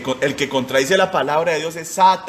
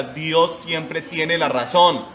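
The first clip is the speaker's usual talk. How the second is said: call and response; much slower than usual. Shown as a demonstration. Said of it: "dígalo usted conmigo".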